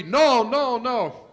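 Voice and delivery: loud, high pleading nos; loud protest. Retold or imitated. Grabbed by the guys, protesting loudly. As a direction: beg them to stop, loud and high.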